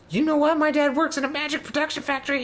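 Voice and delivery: mock little-kid boast; bratty kid boast. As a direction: brag like a playground kid. Playground boast voice.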